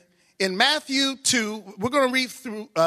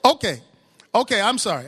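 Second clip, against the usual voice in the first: normal talking voice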